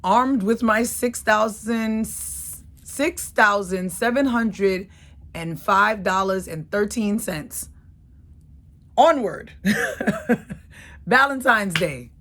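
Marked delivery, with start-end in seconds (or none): 0.0-7.7 triumphant. Real, not acted. Triumphant start of road trip. 9.0-9.5 triumphant. Real, not acted. Triumphant start of road trip. 9.6-11.0 breathy laugh. Real, not acted. Laugh after onward.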